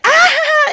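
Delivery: loud, high mock scream through laughter; imitated scream. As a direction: shriek high and loud, playfully imitating him. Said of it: "he's, like, screaming".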